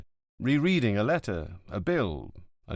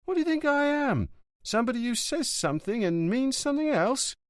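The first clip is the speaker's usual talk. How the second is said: an offended friend, pitched up high and indignant. A narrator's reading. Jejé takes offence at Montalbano, indignant. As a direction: bristle at the insult.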